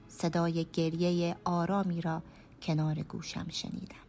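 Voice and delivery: ordinary delivery, nothing marked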